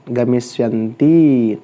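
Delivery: गमिष्यन्ति slowly stretched for pupils to copy; slow dictation. Shown as a demonstration. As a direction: draw out गमिष्यन्ति with a long ending. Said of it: "तीसरा".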